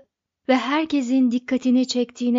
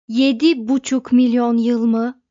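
emphatic outcry, much louder; shouted disbelief; 9 dB louder than usual. A narrator's reading. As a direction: cry it out as an astonished question. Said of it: "diye haykırdılar koro halinde".

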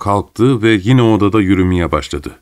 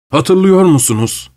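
higher, nostalgic exclamation; carried-away cry; 8 semitones higher than usual. A narrator's reading. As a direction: cry out, swept up in memories. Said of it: "diye bağırdı Pavel Pavlovich".

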